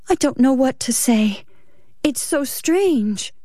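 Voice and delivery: lightly voiced shaken wife; Ruth's voice. Ruth stunned, slow.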